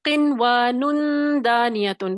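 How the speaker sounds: किनवानुन दानियतुन slow, noon hidden in the nose; slow Quranic recitation of किनवानुन दानियतुन; with ikhfa. Shown as a demonstration. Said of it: "इख्फा के साथ".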